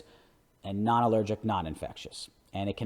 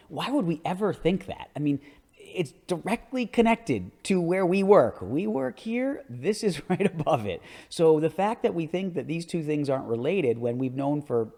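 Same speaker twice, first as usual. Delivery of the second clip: much higher, rising disbelief at colleagues; exasperated, emphatic lecturer; incredulous emphasis; 7 semitones higher, 3 dB louder than usual. Real, not acted. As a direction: hammer home how obvious the link is. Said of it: "it just blows my mind".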